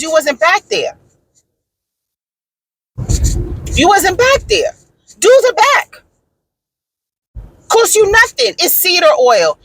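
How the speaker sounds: high-pitched, indignant retold argument; fed-up customer telling them off; heated complaint. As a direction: argue it emphatically, voice pushed high. Retold heated complaint to lawn company.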